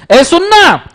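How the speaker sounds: high pleading hey-listen-please; pleading call. Shown as a demonstration. Teacher acting a pleading call to show every emotion is an offer. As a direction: beg for attention. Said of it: "हर emotion में offer ही है".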